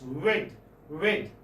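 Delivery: "wet" starting on a rounded w; rounded lips. Shown as a demonstration. Teacher demonstrating the w sound to students, rounded lips. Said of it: "You round your lips".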